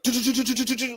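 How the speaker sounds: quick high "tu-tu-tu" stutter; imitated rapid-fire noise. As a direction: rattle off tu-tu-tu like a stream of tweets. Imitates rapid burst of tweets.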